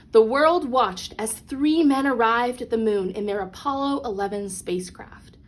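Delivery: usual speaking voice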